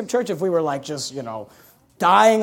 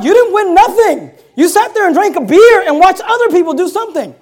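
mocking loud retort; 7 semitones higher, 7 dB louder than usual. Preacher's loud retort to fan.

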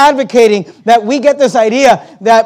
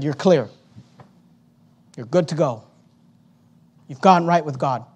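gentle reassurance; 6 semitones lower, 6 dB quieter than usual. Retold or imitated. Paul reassuring the Corinthians.

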